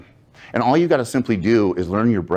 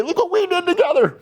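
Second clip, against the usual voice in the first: mock proud cheer. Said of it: "we can say".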